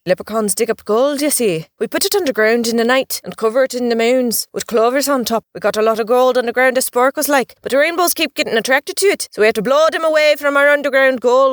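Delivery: chatty Irish leprechaun voice; leprechaun storyteller. Acted. Leprechaun tells of his island, chatty Irish brogue. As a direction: spin the yarn as an Irish leprechaun.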